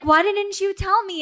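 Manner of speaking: high, loud reproachful question; mock-indignant, high-pitched demand; incredulous demand. Boss asking about the kept secret, loudly incredulous.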